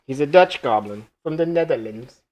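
a badly faked Dutch accent; goofy mock-Dutch voice on the goblin; hammed-up fake Dutch accent, half-hearted; terrible Dutch accent. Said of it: "That's a terrible accent".

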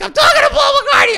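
high, screaming fan hysteria; very loud, shrieking inner freak-out; out of my mind. Retold or imitated. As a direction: scream it like a fan going crazy. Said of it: "I was, like, out of my mind".

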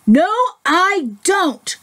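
high, drawn-out mock growl; mild growly protest, pitched high; growled back. A narrator's reading. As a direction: stretch out a stubborn storybook retort. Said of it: "growled the Big Smelly Bear back".

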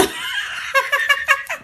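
very high rising laugh; squealing laugh. Real, not acted. Host laughing at viewer's candy question, squealing.

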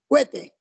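"cohete" said as KWE-te, vowels merged; common colloquial pronunciation. Shown as a demonstration. Teacher admitting what we usually say, "cohete" as kwe-te. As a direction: turn the o of "cohete" into a w glide. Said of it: "solemos decir".

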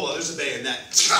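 ordinary talk, nothing marked

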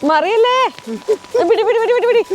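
quick high urgent repeats; urgent alarmed calls. Real, not acted. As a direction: catch it, quickly. Tea about to spill. (പെട്ടെന്നുള്ള പരിഭ്രമ വിളി)

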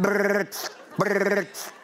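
mimics heavy wheezy breaths, brrrt; heavy breathing imitation. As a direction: buzz out the breathing noise like a mask. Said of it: "und sie machte es vor".

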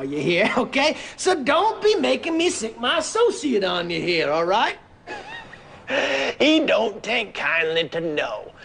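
pushy oilman in a put-on Southern accent. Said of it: "We would have Southern accents".

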